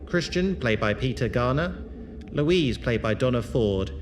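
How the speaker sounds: proud sign-off to the listeners; credits announcer. Acted. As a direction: read the cast list like an announcer. Host reads the closing credits.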